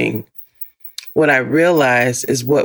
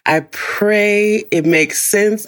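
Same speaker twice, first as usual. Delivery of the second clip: ordinary delivery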